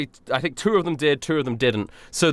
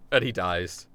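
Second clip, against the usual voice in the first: normal talking voice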